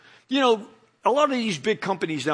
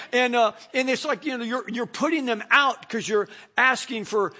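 usual speaking voice

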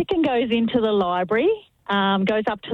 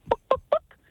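high run of chicken clucks; clucking chicken. Acted. Chicken asking the librarian on the third day, clucking. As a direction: cluck it out like a hen.

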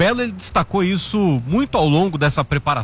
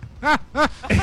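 laughing; 10 semitones higher than usual. Real, not acted. Host joking the coach was lonely, laughing high. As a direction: chuckle at your own joke. Own Valentine's day joke.

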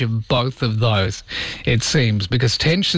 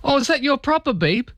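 much higher, mock confused caller; puzzled caller; 12 semitones higher than usual. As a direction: play the baffled friend on the line. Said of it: "And then people would go".

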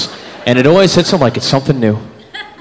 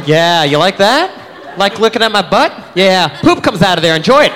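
slow, higher, teasing taunt; mock seductive; 6 semitones higher than usual. Woman taunting the creep as she walks off, slow teasing. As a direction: drag it out, taunting and sassy. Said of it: "And then just go".

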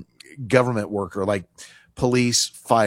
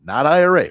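"not I R A" punched louder as a warning; stress on not IRA; 4 semitones higher, 7 dB louder than usual. Shown as a demonstration. Host warning listeners off the IRA, louder and firm. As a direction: bark out "not I R A" firmly and louder. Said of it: "notice how I'm emphasizing".

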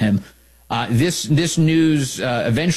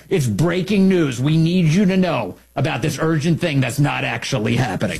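mock breaking news; 4 semitones higher than usual. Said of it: "parody of breaking news".

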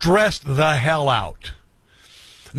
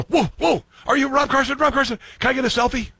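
high, fast excited gush; starstruck fan; 10 semitones higher than usual. Retold or imitated. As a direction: gush like a fan spotting a celebrity. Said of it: "and go".

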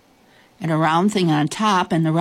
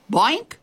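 high, rising springy boink; door boink; 7 semitones higher than usual. A narrator's reading. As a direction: pop out a short boink. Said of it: "the door made a noise".

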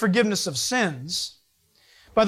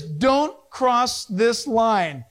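very slow, every word spelled out; stern sign warning; much slower than usual. Retold or imitated. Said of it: "The sign says".

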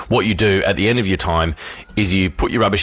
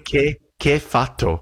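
slow, higher mock Italian; Italian outburst; 6 semitones higher, slower than usual. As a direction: drag it out like an offended Italian. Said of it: "he's saying".

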